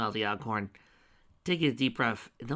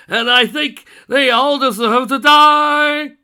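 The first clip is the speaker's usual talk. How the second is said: slow villain gloat building to a loud hateful climax; villain's song; 6 semitones higher, 14 dB louder, much slower than usual. Acted. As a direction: gloat about hating all living things, louder and louder. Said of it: "I'll be singing all the parts".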